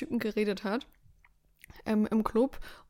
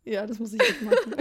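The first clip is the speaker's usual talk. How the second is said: laughing; 5 semitones higher, 5 dB louder than usual. Real, not acted. Joking about writing a novel.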